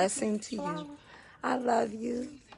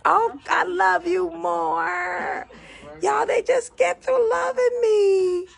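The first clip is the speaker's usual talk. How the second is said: tearful gratitude; 7 semitones higher, 5 dB louder than usual. Real, not acted. Singer thanking livestream fans, voice cracking with tears. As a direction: voice breaking, pitched up and louder with emotion. Overwhelmed by viewers' loving comments.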